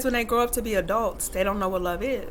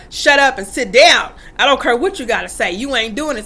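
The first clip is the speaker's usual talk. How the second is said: imitating strict dad; 7 dB louder than usual. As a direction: bark orders like a strict dad, louder. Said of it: "type of daddy".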